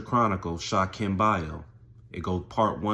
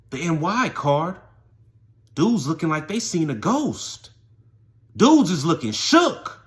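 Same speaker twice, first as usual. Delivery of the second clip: high, loud, drawn-out storytelling; dramatic emphasis; 7 semitones higher, 7 dB louder than usual. Retold or imitated. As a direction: build the shock with loud, stretched words. Storyteller dramatizes inmates' shock.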